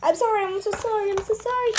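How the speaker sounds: fast, high apology; hurried high pleading sorry; quick pleading sorry. Acted. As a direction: rush out a sorry. Character apologizing again.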